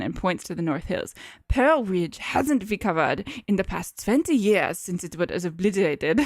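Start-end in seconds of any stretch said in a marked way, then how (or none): 1.5-6.2 measured, put-on German doctor; German accent. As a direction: explain it in a thick German accent.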